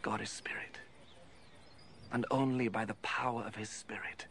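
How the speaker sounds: soft secret whisper. Acted. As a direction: lean in and whisper it. Jesus revealing a solemn truth.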